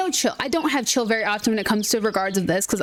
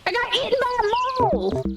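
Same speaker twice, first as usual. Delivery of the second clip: indignant outburst; 10 semitones higher, faster than usual. Real, not acted. Reacting to dying in a game.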